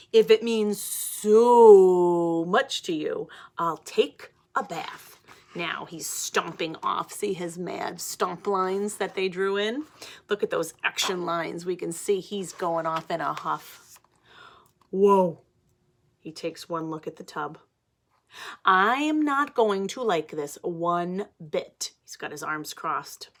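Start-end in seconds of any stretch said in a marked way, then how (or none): 0.1-5.2 slow, loud, put-upon pigeon voice; huffy surrender. Acted. Pigeon grudgingly agrees, huffy. 18.7-21.9 slow, stubborn complaint; sulky pigeon. Acted. Pigeon sulking at the tub.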